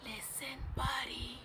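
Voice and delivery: very soft, hushed call; whispering. A narrator's reading. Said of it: "They tried whispering".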